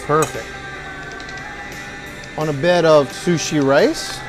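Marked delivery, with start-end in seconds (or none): none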